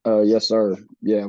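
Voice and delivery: ordinary talk, nothing marked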